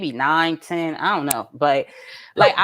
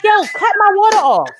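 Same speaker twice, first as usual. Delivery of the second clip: high, loud mock outrage; outraged parent order; 11 semitones higher, 5 dB louder than usual. Mother to son wasting water, outraged order. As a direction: order him loudly, pitch way up.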